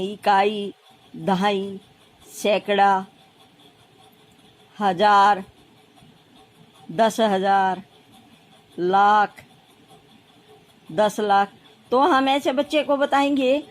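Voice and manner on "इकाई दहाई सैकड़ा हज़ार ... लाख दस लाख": each term alone, long pauses between; measured, separated dictation of terms; reciting place values. Shown as a demonstration. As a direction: recite the list as you write each one. Recites place names while writing them.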